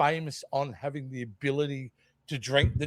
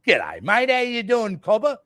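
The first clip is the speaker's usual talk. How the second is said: hammed-up Australian accent, pitched up; broad Aussie accent; 5 semitones higher than usual. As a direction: lean right into a thick Australian twang. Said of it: "I just double down on my Aussie accent".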